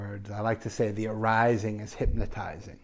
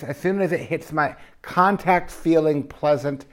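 eager greed; 5 semitones higher, 6 dB louder than usual. Retold or imitated. Acting out greedy eating.

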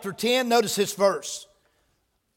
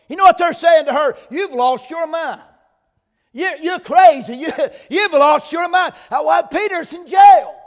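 higher, louder incredulous preaching; fervent preaching; 6 semitones higher, 6 dB louder than usual. Acted. Preacher voicing the unbelieving crowd, loud. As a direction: make them hear the disbelief. Acting disbelief of praying crowd.

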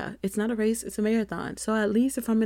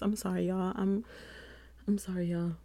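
sheepish; 8 dB quieter than usual. Real, not acted. Sheepish apology, soft.

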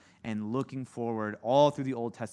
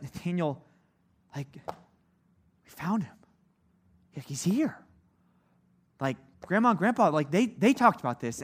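excited retelling; 3 semitones higher than usual. Retold or imitated. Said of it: "this excitement about".

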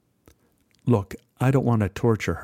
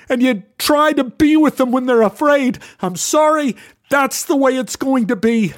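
furious outburst; 8 semitones higher, 4 dB louder than usual. Retold or imitated. Heated argument with son over cat.

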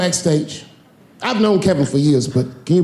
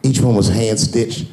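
normal talking voice

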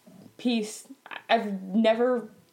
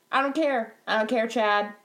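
ordinary delivery

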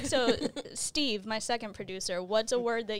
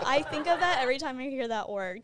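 ordinary delivery

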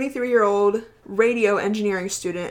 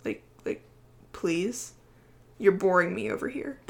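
bored dog voice; 7 dB quieter than usual. Pretend. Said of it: "Loki is here looking at me like".

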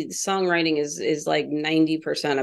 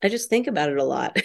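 usual speaking voice

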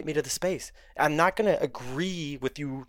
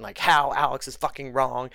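ordinary talk

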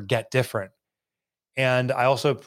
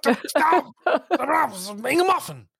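high, garbled mock Scottish rant; hammed-up Scottish gibberish; unintelligible Scottish accent; 13 semitones higher, faster than usual. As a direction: imitate an incomprehensible Scot. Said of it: "couldn't understand what he was saying".

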